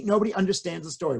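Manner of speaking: plain reading voice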